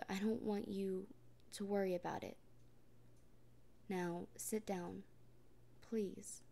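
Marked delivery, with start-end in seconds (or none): none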